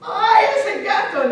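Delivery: high, loud, drawn-out cry; panicked yell. Acted. Father seeing the cat's claws, panicked yell. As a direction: wail in acted terror before fleeing.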